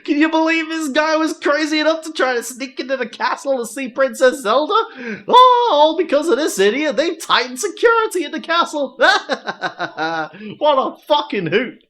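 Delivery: high, strange, cackling guard voice; a giddy NPC guard laughing at the intruder. Acted. Reads NPC guard's laughing lines.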